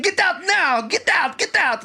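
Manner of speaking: very high, hammed-up shouting; mock panicked yell. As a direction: order them out in mock hysteria. Acting out a panicked man yelling.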